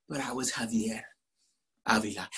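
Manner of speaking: low, soft, let-down delivery; deflated. Acted. Boy stuck with a common name, deflated low mumble. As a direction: drop the voice low and quiet in disappointment.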